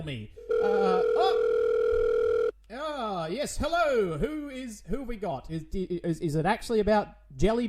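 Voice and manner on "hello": ordinary talk, nothing marked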